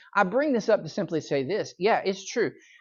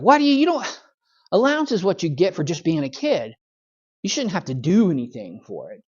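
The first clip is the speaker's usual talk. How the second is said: put-on bratty boy; 2 semitones higher than usual. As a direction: act out an indignant spoiled kid. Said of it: "the little bratty boy just".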